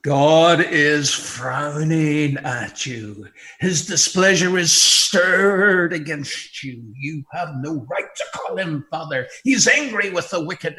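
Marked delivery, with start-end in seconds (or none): 0.0-6.8 slow, loud, grave; solemn warning. Real, not acted. Preacher warning the unsaved, slow and grave. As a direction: weigh each word down.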